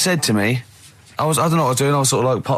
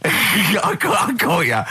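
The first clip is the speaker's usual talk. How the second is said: laughing, higher gleeful gotcha; 5 semitones higher, slower than usual. Real, not acted. Host catching his co-host's slip, laughing gotcha. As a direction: catch him out through laughter.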